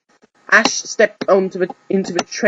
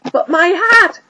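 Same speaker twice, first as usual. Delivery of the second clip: much higher, louder wail of protest; whiny boy; 14 semitones higher, 5 dB louder, slower than usual. Acted. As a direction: cry out for the lost hat. Ash upset about hat.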